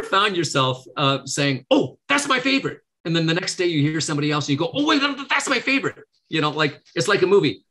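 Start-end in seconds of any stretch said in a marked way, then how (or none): 1.7-2.8 high, bright mock delight at a new favorite; excited exclamation. Listener imitating herself finding a favorite, mock excited. 4.7-6.0 much higher, mock startled discovery; sudden surprise. Speaker imitating someone switching favorites, mock surprised delight. Acting out changing her favorite.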